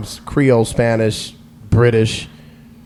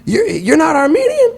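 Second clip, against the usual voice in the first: much higher, incredulous squeak; shocked disbelief. Retold or imitated. The Armenian guy realizing he's not Armenian, shocked. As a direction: gasp it out in disbelief. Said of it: "he immediately went into an urban accent".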